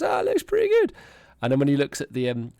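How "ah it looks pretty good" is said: game character. Retold or imitated. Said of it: "and he goes".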